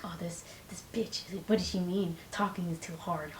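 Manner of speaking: soft, unvoiced sneering whisper; mocking hater. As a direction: whisper it like a scornful critic. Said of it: "people who hate influencers are looking at me like".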